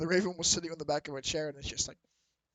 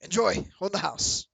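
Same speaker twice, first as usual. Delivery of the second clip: barkeep offer; 4 semitones higher, 8 dB louder than usual. Acted. Barkeep serves the shot.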